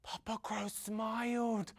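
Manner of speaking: ordinary delivery, nothing marked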